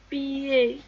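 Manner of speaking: "pied" drawn out as pi-yé; mot modèle. Shown as a demonstration. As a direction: sound out "pied", D silent. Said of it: "Dans le mot". (« pied » allongé en pi-yé)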